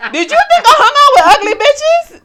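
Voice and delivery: high, loud, laughing indignation; laughing mock outrage. Friends joking about ugly bitches, laughing mock outrage.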